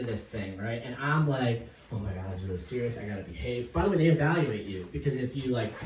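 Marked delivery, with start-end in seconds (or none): 1.9-3.7 nervous inner thought. Retold or imitated.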